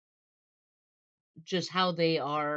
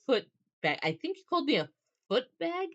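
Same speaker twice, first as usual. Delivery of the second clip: climbing to a high, questioning finish; puzzled; 9 semitones higher than usual. Acted. Man trying to understand what she called him, puzzled. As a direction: repeat the strange word in disbelief. Acted puzzlement at odd word.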